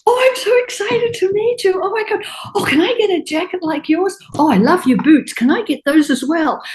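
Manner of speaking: breathless high gush over the boots; gushing Liza. Retold or imitated. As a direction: squeal it like a starstruck star. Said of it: "And she's like".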